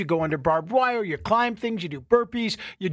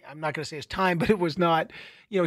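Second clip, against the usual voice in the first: ordinary delivery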